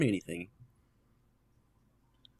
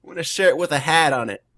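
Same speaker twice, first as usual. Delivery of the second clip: put-on louder Boston accent, "hat" sounding like heart; mock Boston accent; 5 semitones higher, 7 dB louder than usual. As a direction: quote the Bostonian's "shirt with a hat". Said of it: "That's the only thing I know about Boston people".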